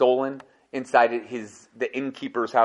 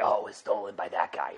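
lower, softer ghostly reply; lightly voiced ghost; voice of the dead; 6 semitones lower, 9 dB quieter than usual. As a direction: murmur the answer like a spirit from beyond. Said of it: "the demons or the dead would say".

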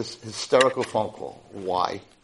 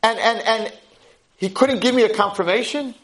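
begging for the recognition she earned; broken and pleading; 11 semitones higher than usual. Retold or imitated.

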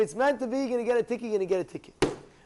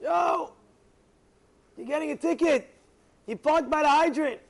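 retold yell, raised high; scream out; 7 semitones higher, 4 dB louder than usual. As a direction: holler it up to someone upstairs. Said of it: "And they scream out".

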